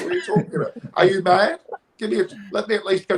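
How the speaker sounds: high, laughing disbelief; laughing exasperation. Real, not acted. Protesting to his friend through laughter. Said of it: "I get a bit emotional".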